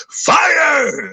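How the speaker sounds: high loud crying shout; pained cry. Retold or imitated. Reliving the spasm, a high crying yell of fire. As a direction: wail the word as if burning. Acting out his burning back.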